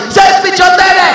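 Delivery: high, loud, slow exploding cry; old lady finally snaps and shouts the forbidden phrase; exasperated outburst. Retold or imitated. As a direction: blurt it out at last like an old lady losing her patience.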